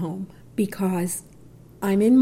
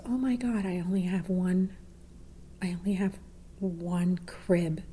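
softer, whispered inner panic; whispered shock; 10 dB quieter than usual. Retold or imitated. Mother hearing of twins, whispered shock. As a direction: breathe the thought out in shock.

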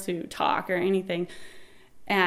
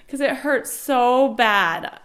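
usual speaking voice

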